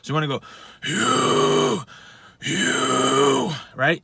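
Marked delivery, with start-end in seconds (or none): 0.9-3.5 low raspy growled scream. Shown as a demonstration. Coach showing the low register, low scream. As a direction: clear the throat in a low tone. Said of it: "with the low tone".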